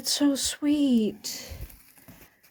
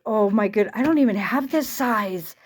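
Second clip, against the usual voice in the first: ordinary talk, nothing marked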